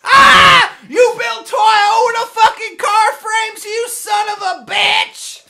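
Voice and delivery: loud, slow, seething yell; outraged roar, dragging the words out; furious shout. Real, not acted. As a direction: bellow every word at him. Real angry shouting match.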